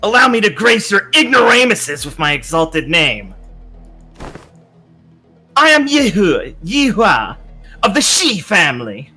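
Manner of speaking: pompous noble. Acted. Yihua announces himself grandly.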